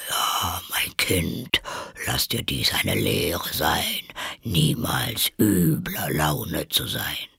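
slow, breath-heavy lecturing voice; old toad mother. A narrator's reading. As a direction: admonish slowly like an aged creature. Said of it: "eine alte Unke".

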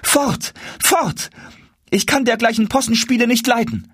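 very high, indignant outcry; repelling cry. A narrator's reading. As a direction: drive the intruder away in a high voice. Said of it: "rief sie". (hoch und abweisend gerufen)

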